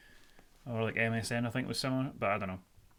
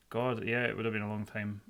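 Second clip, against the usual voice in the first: ordinary talk, nothing marked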